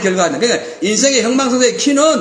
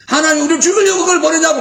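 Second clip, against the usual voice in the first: higher, louder complaining cry; retold yelling; 8 semitones higher, 4 dB louder, faster than usual. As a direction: wail it like angry spies. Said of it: "막 소리를 지르고".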